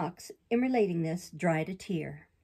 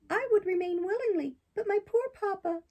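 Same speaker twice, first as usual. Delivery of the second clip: high, bright-eyed puppet, much higher than the narrator; puppet boy voice; 12 semitones higher than usual. Acted. Pinocchio protests to the fairy in a high boyish voice. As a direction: play Pinocchio as an eager young boy defending himself.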